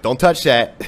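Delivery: imitating dad warning the kids off the heater; dad's warning. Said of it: "My dad would be like".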